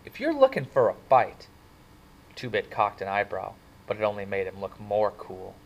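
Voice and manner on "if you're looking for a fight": threatening. A narrator's reading. Soc threatens greasers.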